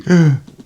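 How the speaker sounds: a sudden high gasp of a dying man; sharp gasp. Retold or imitated. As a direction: gasp as the breath is cut off. Patient dying mid-struggle.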